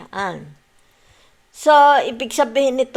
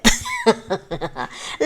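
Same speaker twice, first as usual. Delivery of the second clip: soft laugh; 6 dB quieter than usual. Real, not acted. Keeps laughing after the tease.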